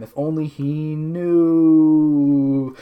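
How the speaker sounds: very slow, stretched narration; drawn-out melodrama. A narrator's reading. Narrator hinting at a secret, slow melodrama. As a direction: hold the last word out long.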